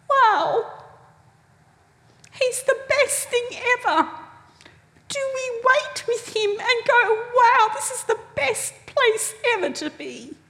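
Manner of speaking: high, awed wow; delighted wonder. Retold or imitated. To the congregation, a high and awed delighted wow.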